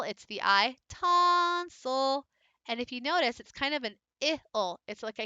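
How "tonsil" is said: Tonsil called out high, ton held long; calling the dog. Shown as a demonstration. Teacher calling Tonsil to show the accent. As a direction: call Tonsil like a dog's name. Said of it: "In tonsil".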